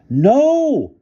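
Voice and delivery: long high loud howl. A narrator's reading. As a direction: howl it in dismay. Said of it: "howled Bruno".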